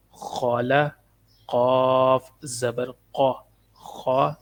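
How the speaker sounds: slow, drawn-out letter-by-letter drill; measured Qaida teacher's recitation; spelling drill recitation. Shown as a demonstration. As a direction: intone each letter, then its syllable. Drills spelling out Quranic syllables.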